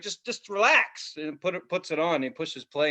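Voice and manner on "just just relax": slow, high, soothing cousin; mock soothing. Cousin soothing the startled kid, slow and high. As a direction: ease him into it gently. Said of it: "He's like".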